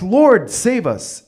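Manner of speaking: panicked plea. Retold or imitated. Disciples begging in storm.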